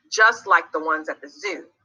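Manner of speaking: ordinary delivery, nothing marked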